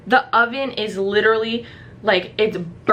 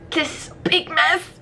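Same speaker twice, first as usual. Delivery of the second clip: high-pitched, building tearful whine; hammed-up squealing meltdown over the mess; mock wailing; 6 semitones higher, 3 dB quieter than usual. Neat freak facing a burnt kitchen, mock wail.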